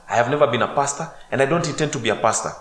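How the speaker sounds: loud, high, fast insistence; insistent denial. Retold or imitated. To the second usher, loud fast insistent denial. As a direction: hammer home that he's no pastor. Insisting he's not a pastor.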